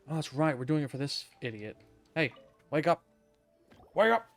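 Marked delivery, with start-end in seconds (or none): none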